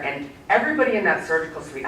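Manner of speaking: ordinary delivery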